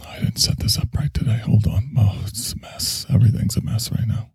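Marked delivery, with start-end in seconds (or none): 0.1-3.1 whispered fumbling with the setup. Real, not acted. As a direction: mutter it under your breath.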